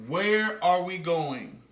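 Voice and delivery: "where are we going" slowly, word by word; slow model sentence. Shown as a demonstration. Teacher models a child's question to Mom, slow and spaced out. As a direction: stretch each word for learners to copy. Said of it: "Say it with me".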